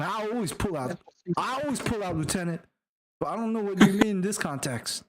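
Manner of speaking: high-pitched, bewildered soldier voice; befuddled underling querying an order; confused sergeant. Acted. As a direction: protest in comic confusion, pitched well up. Acts confused sergeant, joking on order.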